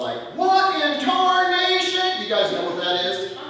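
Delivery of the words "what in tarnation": high, drawn-out twangy Southern exclamation; big slow mock-Southern holler; Ryan. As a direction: stretch it out in a hammed-up Southern twang. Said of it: "if you've heard Ryan, he's, like, talking about".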